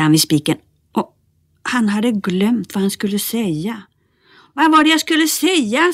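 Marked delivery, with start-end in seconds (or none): 4.5-5.9 much higher calling shout. Acted. Bertil calls down to Nisse, a high shout. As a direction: call down the stairs to Nisse. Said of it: "skrek han ner till Nisse".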